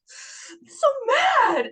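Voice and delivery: very high, drawn-out so mad; reliving teenage rage, high and slow; mock fury. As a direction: stretch it out, half crying. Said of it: "feeling where you're".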